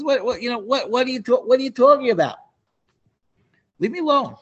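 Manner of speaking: high, flustered, stumbling protest; flustered brush-off. Retold or imitated. Achimelech tells King Shaul to leave him alone, flustered and high. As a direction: get flustered and push him away, voice up high.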